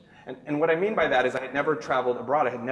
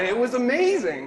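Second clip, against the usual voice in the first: high, louder, gushing; giddy; 11 semitones higher, 5 dB louder than usual. Real, not acted. Remembering his sudden popularity, high giddy gush.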